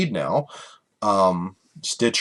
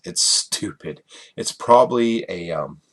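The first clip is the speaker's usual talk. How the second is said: ordinary talk, nothing marked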